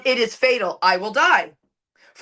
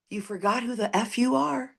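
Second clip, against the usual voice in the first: hushed repeat; 7 dB quieter than usual. Real, not acted. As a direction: let it sink in, nearly under breath. Repeats line quietly, censored.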